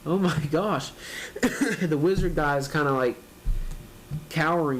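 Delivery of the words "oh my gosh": ordinary talk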